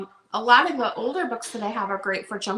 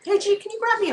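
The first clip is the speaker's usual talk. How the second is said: calling out; 10 semitones higher, 3 dB louder, faster than usual. Real, not acted. Calling to someone off camera.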